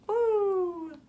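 plain reading voice

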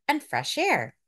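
usual speaking voice

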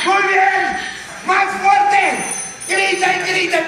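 high, loud urging; rousing yell. Acted. Anchor egging on shouting viewers, rousing yell.

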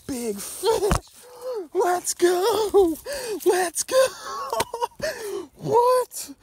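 loud, high, near-screamed joy over a huge catch; real triumphant whoops, pitch way up; ecstatic shouting. Real, not acted. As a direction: yell like you just landed your biggest fish ever. Lands big fish, yells.

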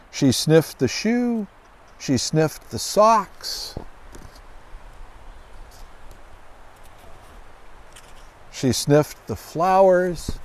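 slow storybook build. Acted. Slow dramatic storybook repetition.

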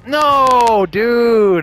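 shouted "No, dude!"; loud. Real, not acted. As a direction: yell in dismay. Losing the round.

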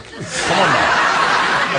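laughing through a playful challenge; breathy laughing dare. Real, not acted. Jokey challenge to arm wrestle.

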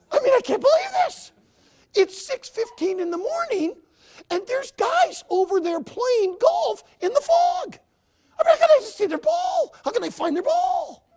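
can't believe what he's seeing; incredulous. Real, not acted. Preacher exclaiming in disbelief about fog golfers.

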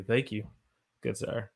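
ordinary talk, nothing marked

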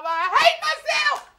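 desperate high cry on hate. Shown as a demonstration.

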